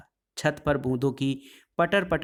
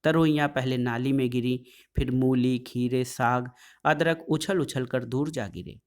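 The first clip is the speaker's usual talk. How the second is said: ordinary delivery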